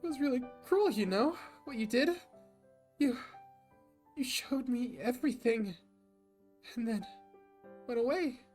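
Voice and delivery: high, loud, tearful reproach; Anna sobbing. Acted. Anna sobbing to Jack that he left her, high and tearful. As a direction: cry out the hurt between sobs. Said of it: "her sobs make it hard for her to speak".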